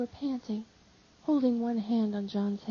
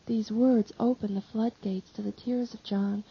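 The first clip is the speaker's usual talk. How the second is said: usual speaking voice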